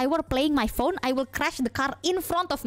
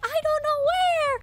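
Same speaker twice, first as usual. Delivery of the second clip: very high, helpless mock wail; lost and flustered, pitched way up; panicked wail. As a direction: whine it in hammed-up panic. Acting own panicked reply.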